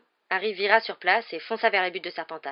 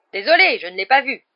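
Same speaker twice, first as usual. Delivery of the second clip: raised-voice apology over the booing; loud excuse; 5 semitones higher, 12 dB louder than usual. A narrator's reading. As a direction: call out an excuse across the pitch. Player excuses his foul over booing. (excuse lancée à voix haute)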